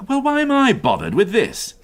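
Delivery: why me, irritated; exasperated. Acted. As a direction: inspector grumbling at petty crime. Exasperated at trivial report.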